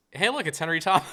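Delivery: high, loud punchline exclamation; mock excited recognition. Ending result of all that effort, mock excited. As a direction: call it out like a sudden sighting. Punchline, acted viewer spotting the actor.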